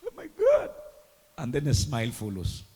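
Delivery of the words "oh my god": mock joyful gasp. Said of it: "you know how tears of joy come?".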